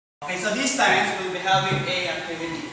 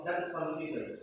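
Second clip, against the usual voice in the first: ordinary talk, nothing marked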